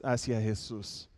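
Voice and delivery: ordinary talk, nothing marked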